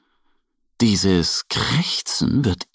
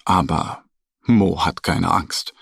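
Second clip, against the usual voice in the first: usual speaking voice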